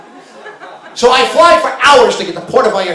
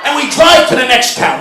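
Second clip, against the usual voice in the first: ordinary delivery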